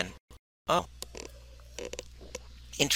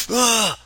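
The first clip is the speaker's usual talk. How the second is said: disgusted groan; 13 semitones higher, 6 dB louder than usual. Retold or imitated. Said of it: "I was just like".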